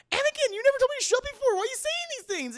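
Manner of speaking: very high, fast, whiny pleading; high whiny Padme. Retold or imitated. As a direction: squeal it in hurt disbelief. Padme hurt, acted.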